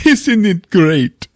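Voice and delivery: high, drawn-out mock enthusiasm; mock delighted Javier. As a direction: gush it slowly, up high. Mimics Javier's delighted remark.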